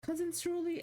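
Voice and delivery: high, sing-song kid voice mocking them; kids calling out. Streamer mimicking the kids calling her Cousin Surly, high and sing-song. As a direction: mimic the little kids chirping her name. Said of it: "They're like".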